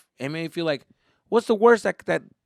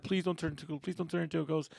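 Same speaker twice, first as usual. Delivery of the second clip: soft, slightly timid repeating; retold fearful plea; 3 semitones higher, 7 dB quieter than usual. Boy fearing dad becomes a ghost, soft pleading. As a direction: plead under your breath, mildly scared. Scared child begging under breath.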